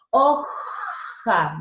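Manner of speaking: HOJA with a long scraping "jjj" in the middle; rasping J. Shown as a demonstration. Teacher demonstrating the J to students, rasped and prolonged. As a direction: drag out the throaty J in HOJA. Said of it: "Dense cuenta que raspa".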